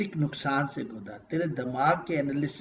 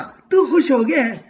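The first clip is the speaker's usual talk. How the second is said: delighted question; 7 semitones higher, 9 dB louder than usual. Acted. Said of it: "دیکھیں کتنی خوبصورت ہے".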